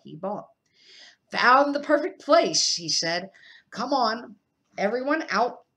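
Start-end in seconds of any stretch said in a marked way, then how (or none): 1.3-2.8 loud, higher, smug uncle voice; hearty Uncle Vernon. Acted. 3.7-5.7 a bit louder, higher barking uncle; bossy Uncle Vernon. Acted. Uncle Vernon orders family.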